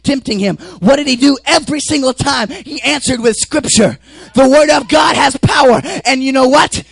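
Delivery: loud, high-pitched sermon shout; full-force preaching. Acted. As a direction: preach it at the top of your voice.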